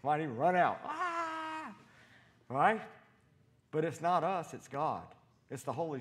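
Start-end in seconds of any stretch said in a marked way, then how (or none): 0.9-1.7 high short fake scream; hammed-up startled yelp; mock scared scream. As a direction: yelp like someone fleeing. People run out scared.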